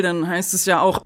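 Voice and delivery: ordinary talk, nothing marked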